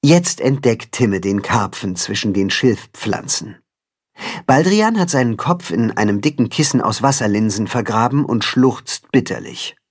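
plain reading voice

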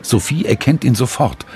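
plain reading voice

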